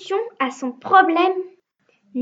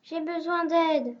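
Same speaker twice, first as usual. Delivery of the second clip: troubled; 8 dB quieter than usual. A narrator's reading. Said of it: "très embêté".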